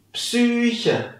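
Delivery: "Psyche" with a long Ü sound; model word. Shown as a demonstration. Teacher modelling "Psyche" again. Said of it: "Wie ihr hört".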